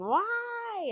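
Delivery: high, drawn-out exasperated "why"; frustrated wail. Retold or imitated. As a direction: imitate your own meltdown wail. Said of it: "I'm just there just saying".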